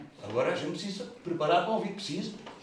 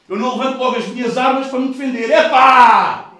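loud, higher burst; preaching shout; 7 semitones higher, 14 dB louder than usual. Real, not acted. Preacher declares to the church, loud and raised. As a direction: fling it out defiantly.

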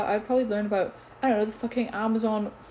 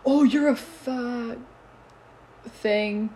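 mock boy's taunt; 3 semitones higher, 3 dB louder, much slower than usual. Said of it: "copy what this boy said at lunch".

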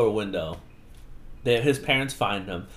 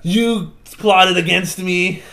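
slow, loud, high mock outrage; acted accusation; 6 semitones higher, 7 dB louder, much slower than usual. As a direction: draw out the accusation melodramatically. Acts Steve's accusation.

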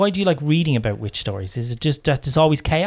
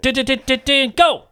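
playful countdown; 9 semitones higher than usual. Acted. Host counts down a quick list.

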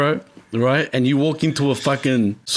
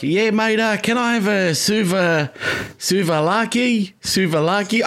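put-on higher, slow Australian ocker accent mangling "suvalaki"; 6 semitones higher, slower than usual. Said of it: "they'd be like".

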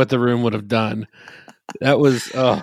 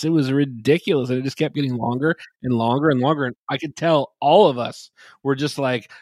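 usual speaking voice

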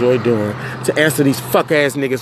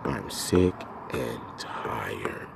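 drained whisper; 10 semitones lower, 13 dB quieter, much slower than usual. Real, not acted. Repeats it drained.

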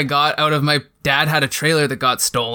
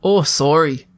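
put-on Canadian accent; 3 dB louder than usual. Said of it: "Say sorry in a Canadian accent".

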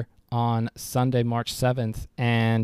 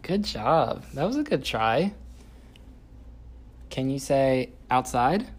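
put-on reading to a toddler; 2 semitones higher, 5 dB quieter than usual. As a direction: coax a toddler, bright and playful.

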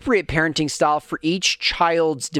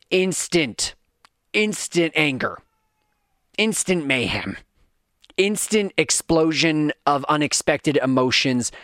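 normal talking voice

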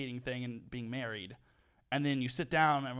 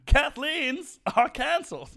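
mock news announcer; 12 semitones higher, 11 dB louder, slower than usual.

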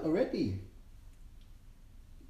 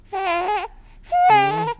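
high, loud squeal; 14 semitones higher, 14 dB louder than usual. Real, not acted. Squeal at baby.

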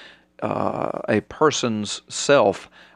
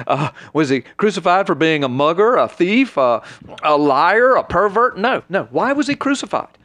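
preacher mocking a skeptic, building sarcasm; sarcastic rebuttal; 2 semitones higher than usual. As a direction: needle him with scornful questions. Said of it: "I always like to look at him and say".

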